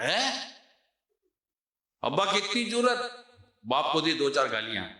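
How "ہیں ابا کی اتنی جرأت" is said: high, sharp shocked question; stunned and rising to anger; outraged disbelief. Retold or imitated. Youngest son hearing mother's story, outraged disbelief.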